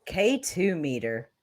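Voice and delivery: ordinary talk, nothing marked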